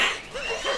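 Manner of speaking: high breathless giggle; can't hold back a giggle. Real, not acted. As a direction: burst into a giggle. Laughing at own story.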